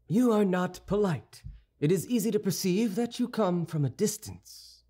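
a hint of lofty disdain; cool reproach. Musketeer telling the youth he is rude, mild disdain.

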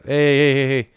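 loud, sharp calls to get attention; urgent calling out; calling out hey. Real, not acted. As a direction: snap him out of it with a loud hey. Calling out to the character.

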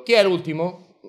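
loud call over the crowd, rising at the end. Acted. Man calls loudly into the crowded waiting room. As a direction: shout who is last to the room.

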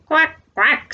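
high quacking duck call. Acted. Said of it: "says the ducks".

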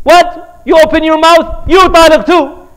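loud, high furious shout; angry outburst. Retold or imitated. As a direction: shout at her for opening her mouth. Husband snaps at third wife.

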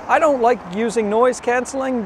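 digital, processed noise-canceling audio; noise-canceled mic sound. Real, not acted. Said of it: "I find it's a little digital".

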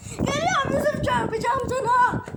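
high, loud shouted warning; warning yell. Real, not acted. Spinning boy warning Yusuf he will crash. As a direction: yell it at him as you spin.

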